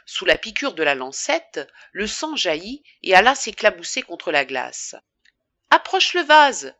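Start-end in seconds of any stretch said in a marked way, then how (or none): 5.7-6.7 higher and louder sudden urgent order; urgent exclamation. A narrator's reading. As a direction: snap out the order as the blood spurts. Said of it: "s'exclama Charles".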